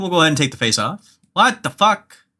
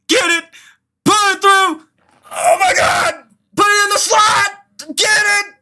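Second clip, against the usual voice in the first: will the team to score; urgent cheering. Real, not acted. Urging his team on, shouting.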